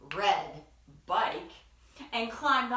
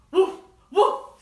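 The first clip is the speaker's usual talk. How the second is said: loud, higher imitated woof; dog bark; 9 semitones higher, 12 dB louder than usual. Dog answering duck with a loud woof. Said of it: "said Dog".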